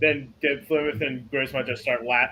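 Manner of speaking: usual speaking voice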